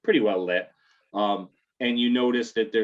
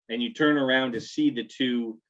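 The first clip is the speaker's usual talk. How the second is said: ordinary talk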